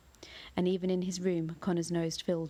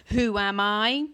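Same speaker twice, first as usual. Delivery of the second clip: slow, much louder roar of a question; louder booming monster; 3 semitones higher, 9 dB louder, much slower than usual. A narrator's reading. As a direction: boom it out slowly, swelling. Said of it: "its voice getting louder".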